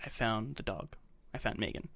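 very soft, hushed imitation of a whisper; retold whisper. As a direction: confide it under your breath, imitating her. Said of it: "she whispers into Dean's ear".

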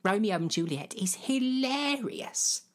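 high, drawn-out gushing queen; mock royal delight; imitating the Queen. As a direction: swoop up high and stretch the verdict. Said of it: "That is what she said".

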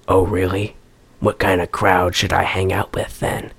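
low, soft leading-man imitation; hammed-up suave reply, deep and hushed; mock tough-guy flirt. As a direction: play the movie cop flirting, low and quiet. Acting out male lead's flirting.

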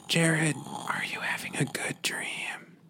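slow breathy whisper. Acted. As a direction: whisper it into his ear. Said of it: "Maybe if we whisper in his ear".

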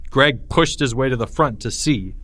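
ordinary delivery, nothing marked

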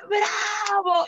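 high, excited shout; girl shouting bravo. Retold or imitated. A little girl cheering the storyteller, excited shout. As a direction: cheer it out like a thrilled child. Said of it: "la niña así".

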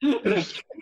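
short amused laugh; high little burst of laughter. Real, not acted. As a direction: laugh at being teased. Laughs at the teasing remark.

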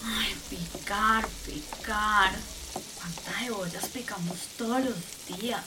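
low-pitched, soft weary chant; slow, worn-down cook; weary grumbling. Acted. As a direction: grumble over endless chopping. Loop restarts, weary chopping.

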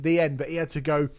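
ordinary talk, nothing marked

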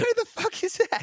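high disbelieving outburst; who on earth is that, shocked; incredulous. Real, not acted. Shocked at unknown guess.

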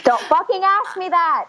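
high-pitched exasperated rebuke; exasperated retort. Acted. Answering strangers' wheelchair messages, exasperated high retort. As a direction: push the pitch way up, fed up with being asked.

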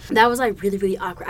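ordinary talk

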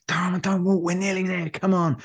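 rally a stranger to keep running; urging runners on. Retold or imitated. Urging walkers at the marathon.

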